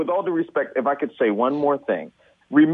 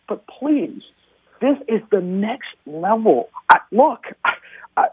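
emotional pleading; 6 semitones higher than usual. Real, not acted. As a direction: voice rising and cracking, begging. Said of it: "I'm literally begging of you".

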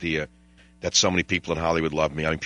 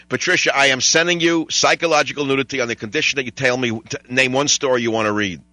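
usual speaking voice

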